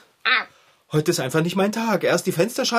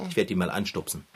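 quiet aside; 8 semitones lower, 8 dB quieter, faster than usual. Acted. Aside before nudging him.

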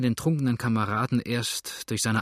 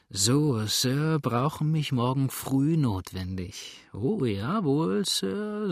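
put-on slow, slurring drunk with wild pitch swings; town drunk; slower than usual. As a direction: ramble mockingly like a sloppy drunk. Drunk rants mocking Kelly. (betrunkener Schwätzer, lallend und spöttisch)

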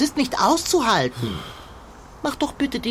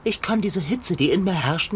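ordinary delivery

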